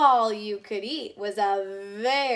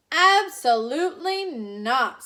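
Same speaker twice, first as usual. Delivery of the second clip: louder, slow, emphatic no; firm refusal; 4 semitones higher, 7 dB louder, slower than usual. Acted. Grouchy sister refusing the outing, slow and firm. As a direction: shut the idea down.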